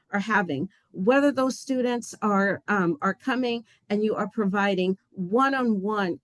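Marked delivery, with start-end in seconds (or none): none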